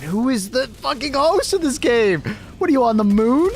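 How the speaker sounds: high, exasperated shouting at the lag; real gamer rage; frustrated rant. Real, not acted. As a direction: vent at the laggy host. Raging at game lag.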